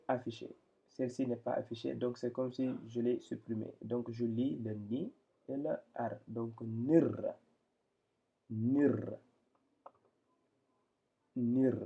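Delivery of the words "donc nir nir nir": "nir" as one joined syllable with rolled r; "nir" said on its own, the final r trilled; linked reading. Shown as a demonstration. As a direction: run ni into r to say "nir". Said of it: "Ça, c'est la liaison".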